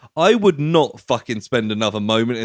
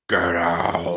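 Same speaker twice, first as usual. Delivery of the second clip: low, drawn-out demon impression; mock demonic growl; 5 semitones lower than usual. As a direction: imitate a bad film demon, long and low. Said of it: "like, a demonic voice".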